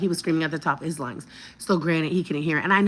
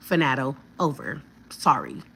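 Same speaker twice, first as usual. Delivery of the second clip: ordinary delivery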